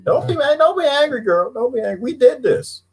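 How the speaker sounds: high, coaxing plea; a touch of high sing-song calming; soothing high voice. Pretend. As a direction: talk her down sweetly. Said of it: "don't do the angry monkey".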